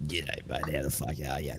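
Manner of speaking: a hint of bogan Australian; low, soft broad Aussie greeting; Australian accent. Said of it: "it's like me talking now".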